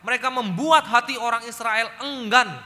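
loud sermon build on Moses' misunderstanding; full-force preaching. Acted. Preacher retelling Moses to the congregation, loud and emphatic. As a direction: proclaim it loudly to the church.

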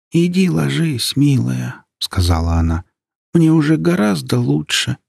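put-on high, gentle, weak old voice; weak old woman voice. A narrator's reading. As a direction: clear but fading grandmother. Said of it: "Её голос был ясен, но очень слаб".

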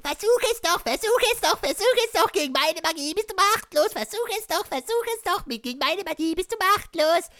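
very high falsetto chant; taunting. Acted. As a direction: squeal the dare in a sing-song loop. Character taunts back in falsetto.

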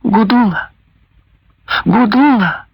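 high, anxious calling. A narrator's reading. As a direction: shout her name desperately.

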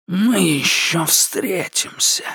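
slow menacing creature voice sinking to a whisper; creeping nightmare whisper. Acted. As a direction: order it like a nightmare breathing close. The shadow's parting threat.

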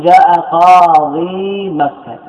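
each word drawn out slowly for the class; slow model sentence. Shown as a demonstration. Teacher reading a grammar example slowly to students. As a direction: spell out جاء قاضي مكة slowly and clearly. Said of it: "فتقول".

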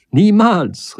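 lauter, hoher Aufschrei „Niemals“; empört hinausrufen; Ausruf. A narrator's reading. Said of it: "rief sie". (sie ruft ihm entrüstet zu, laut)

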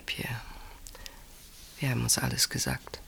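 whispered, resigned farewell; soft whisper. Acted. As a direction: whisper it, letting go. Tender farewell. (leises, resigniertes Flüstern)

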